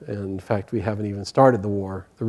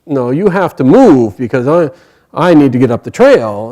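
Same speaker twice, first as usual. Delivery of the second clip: loud, emphatic insistence; insistent demand; 3 semitones higher, 10 dB louder than usual. Acted. Bhima arguing with the monkey on the trail, insistent demand. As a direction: push back hard and loud.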